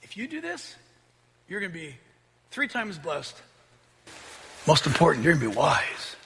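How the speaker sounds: very soft, coaxing promise; tempting Satan. Acted. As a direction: entice them quietly, as the sly serpent. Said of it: "one of Satan's lines to her".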